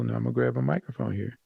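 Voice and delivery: quiet, distant voice with no mic; unamplified low voice. Shown as a demonstration. Teacher shows the class how low talk sounds unamplified, distant and soft. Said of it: "and I'm talking low".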